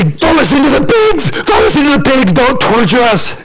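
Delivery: high, shouted begging; frantic demons. Acted. Demons begging Jesus about the pigs, frantic. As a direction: plead wildly at a high pitch, easing off.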